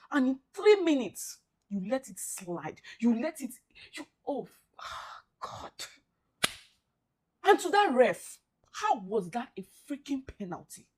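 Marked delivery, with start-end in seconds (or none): 5.4-5.7 defeated sigh. Real, not acted. Exhausted sigh after collapse.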